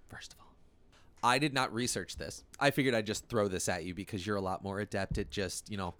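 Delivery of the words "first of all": whispered, trailing off into silence; fading to a whisper. Acted. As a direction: let it fade out to nothing. Repeated phrase fading to whisper.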